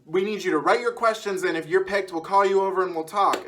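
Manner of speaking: loud, high-pitched studio announcer imitation; mock announcer addressing the audience. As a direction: project it to a whole waiting crowd like an announcer. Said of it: "there's a guy he's announcing".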